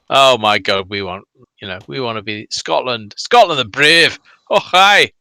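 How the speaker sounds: rally the Scots with mock fervour, ending on a shout; mock Scottish nationalist battle cry; Celtic supporter impression. Voices Celtic supporters' patriotic cry.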